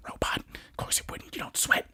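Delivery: sneering stage whisper; whispered taunt at the robot; mocking whisper. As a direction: hiss it like a snide aside. Mocking aside to a character.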